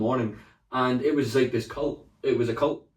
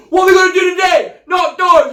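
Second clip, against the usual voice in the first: roaring hype call, much higher and louder; rally shout; 14 dB louder than usual. Retold or imitated. Sales leader hyping the office, loud shouted chant. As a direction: whip up the sales floor. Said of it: "And he'd go".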